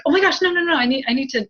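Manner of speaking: high, loud flustered rush; mock alarm. Medium imitating worried spirits, high and flustered. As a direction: spirits fussing to lift her up. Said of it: "they're just like".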